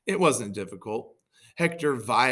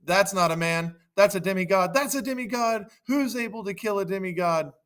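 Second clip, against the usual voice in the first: high, alarmed outcry easing in volume; hammed-up crowd panic; dismayed shout; 8 semitones higher, 6 dB louder than usual. Trojans realise they face a demigod, high dismayed shout. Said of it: "The Trojans shouted".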